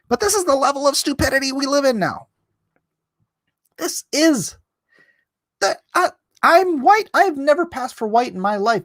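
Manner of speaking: jumps way up into a high exasperated pitch; high-pitched ranting outrage. Real, not acted. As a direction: squeak it out in disbelief. Exasperated rant on stupidity.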